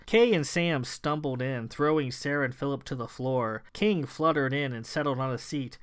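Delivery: usual speaking voice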